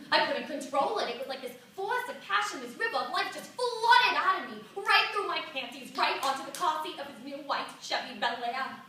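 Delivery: loud, high rush of passion; impassioned, high-pitched outpouring; carried away. Acted. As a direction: relive it at full volume, swept along. Relives the uncontrollable flood of passion.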